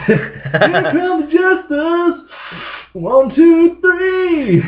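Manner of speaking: hammed-up high battle cry, laughing through it; mock battle cry. Laughing high-pitched mock battle cry before a monster hit. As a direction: play a cartoon hero charging in for a giant hit. Mimics taking huge bong hits.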